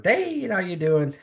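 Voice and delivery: high-pitched mock hello, easing down; imitated chirpy greeting. Churchgoers bouncing off the pastor, chirpy imitated greeting.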